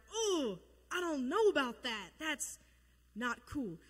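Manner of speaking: hammed-up hesitant objection, falling away at the end; mock reluctance. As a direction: play a comically uneasy refusal. Acting out Jonah's reluctance.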